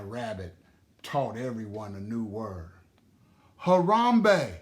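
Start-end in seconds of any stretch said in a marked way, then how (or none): 3.6-4.6 loud joyful call, high and ringing. A narrator's reading. Grandma teaching the dancers a word, calling it out loud. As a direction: call it out to the dancing friends. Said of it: "she called out as her friends danced".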